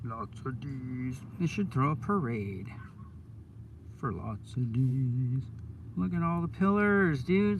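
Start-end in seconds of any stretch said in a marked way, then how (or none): 0.0-1.2 slow soft suggestive. Real, not acted. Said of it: "innuendo joke repeated". 4.0-5.4 slow suggestive punchline. Real, not acted. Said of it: "innuendo joke repeated".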